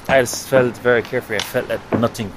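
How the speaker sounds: normal talking voice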